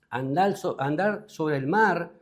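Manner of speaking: normal talking voice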